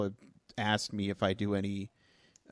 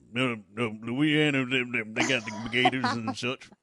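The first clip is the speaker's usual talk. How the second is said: Louisiana accent. Shown as a demonstration. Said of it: "Can you show me what a Louisianan accent sounds like?".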